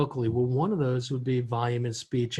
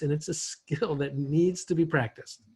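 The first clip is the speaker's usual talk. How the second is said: ordinary delivery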